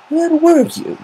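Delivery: high, prim scolding voice; disapproving. Acted. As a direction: sound put out and judgmental. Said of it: "her tone disapproving".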